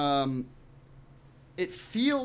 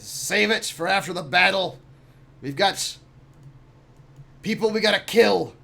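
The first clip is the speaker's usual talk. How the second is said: raised, pressing voice; gruff captain in a hurry; 5 semitones higher, 3 dB louder than usual. Acted. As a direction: cut off the argument, urging action. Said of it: "He says".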